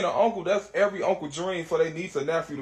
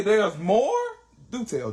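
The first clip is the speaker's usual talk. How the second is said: eager surprise; 3 semitones higher, 4 dB louder, slower than usual. Retold or imitated. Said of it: "I say".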